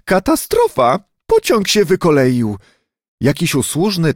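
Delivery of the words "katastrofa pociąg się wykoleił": high, frightened shout of disaster; panicked cry. A narrator's reading. Lady by the window screams in panic about a derailment. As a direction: cry out as if the train crashed. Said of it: "krzyknęła".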